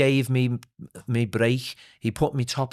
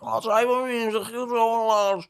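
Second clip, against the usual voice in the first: high, exaggerated Scouse accent; put-on, high-pitched Scouse accent; mock thick local accent; 11 semitones higher than usual. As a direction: mimic a lad's broad Liverpool accent. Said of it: "I don't talk like".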